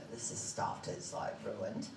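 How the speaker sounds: stunned murmur. Retold or imitated. Woman after a crash, muttering to herself in shock, hushed. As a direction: mutter it like you've just seen the wreck.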